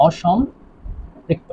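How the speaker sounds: অসম্পৃক্ত very slowly, split in two with a pause; drawn-out dictation. Shown as a demonstration. Teacher spelling out a term to students, অসম্পৃক্ত slowly in pieces. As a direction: stretch and break up অসম্পৃক্ত for note-takers.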